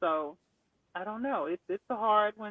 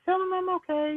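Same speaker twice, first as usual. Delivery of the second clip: high, louder imitation of Lynn insisting; imitating Lynn; 12 semitones higher, 6 dB louder than usual. Friend retelling Lynn's insistence, high imitation. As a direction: raise the pitch and press the words as Lynn. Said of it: "And she's like".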